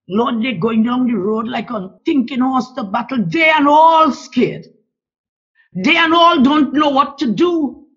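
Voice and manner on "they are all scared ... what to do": crying out. Real, not acted. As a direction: cry out for them in desperation. Prayer peaks in anguished crying out.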